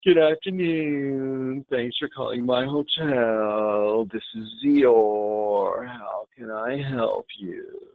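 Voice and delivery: very slow, glum donkey drone; mournful, dreary phone greeting; Eeyore. Acted. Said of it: "We do not wanna be Eeyore the donkey".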